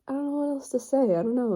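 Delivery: normal talking voice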